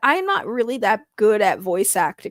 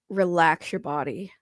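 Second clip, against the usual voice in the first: ordinary delivery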